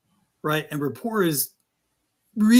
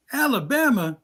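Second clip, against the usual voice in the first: Alabama pitched up in disbelief; incredulous Alabama; 6 semitones higher than usual. Retold or imitated. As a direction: echo the word as if it can't be true. Caller disbelieving the answer.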